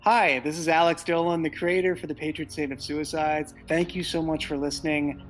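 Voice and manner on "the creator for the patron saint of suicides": ordinary talk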